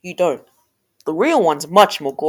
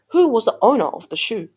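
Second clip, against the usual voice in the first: ordinary delivery, nothing marked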